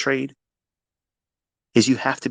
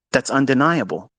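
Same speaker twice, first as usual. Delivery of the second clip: ordinary delivery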